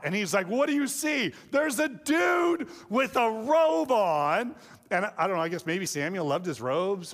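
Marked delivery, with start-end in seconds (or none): 0.5-1.3 high, urgent acted question; mock alarm. Saul reacts to the shrieking witch. 1.5-4.5 high, drawn-out mock panic yell; freaking out. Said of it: "she starts freaking out".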